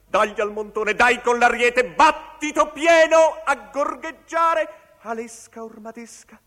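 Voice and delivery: very high and loud, the end slowed and warbled; shouted declamation. Acted. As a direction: pound out the ram's blows. Ramming blows shouted in recitation. (declamazione gridata, acutissima)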